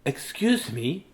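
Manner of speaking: slow, soft, tentative call; timid penguin. A narrator's reading.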